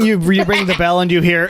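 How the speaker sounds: normal talking voice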